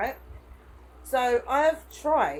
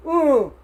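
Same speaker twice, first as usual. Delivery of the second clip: loud exaggerated groan of annoyance; mock groan; 7 semitones higher, 13 dB louder than usual. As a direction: groan loudly like a fed-up porter. Porter groaning retold.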